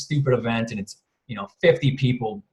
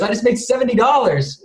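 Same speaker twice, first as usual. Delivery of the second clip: much higher, thrilled wife voice; imitating his wife's excited squeal; 9 semitones higher than usual. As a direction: squeal it like she just won. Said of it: "jumping up and down, freaking out".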